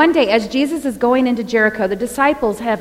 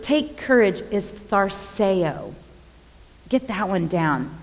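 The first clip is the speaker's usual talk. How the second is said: ordinary talk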